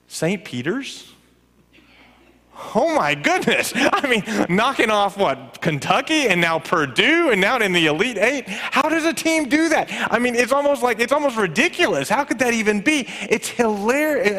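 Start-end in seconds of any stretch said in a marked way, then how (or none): 2.7-14.4 amazed. Real, not acted. Amazed at basketball upset.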